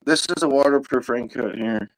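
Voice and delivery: ordinary delivery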